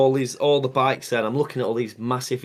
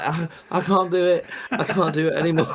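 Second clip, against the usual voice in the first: laughing; 4 semitones higher than usual. Retold or imitated. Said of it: "and I'm like".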